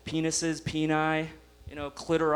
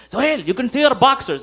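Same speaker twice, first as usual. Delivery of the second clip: mom's voice; 6 semitones higher, 6 dB louder than usual. Retold or imitated. Mom scolds son.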